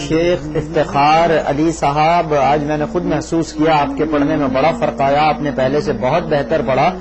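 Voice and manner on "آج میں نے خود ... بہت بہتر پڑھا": ordinary delivery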